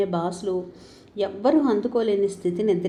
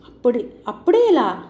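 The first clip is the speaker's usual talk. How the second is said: louder, startled; surprised question; 3 semitones higher, 6 dB louder than usual. Acted. Husband startled that the milkman left, surprised. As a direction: ask it as if caught off guard.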